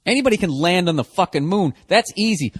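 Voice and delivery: ordinary talk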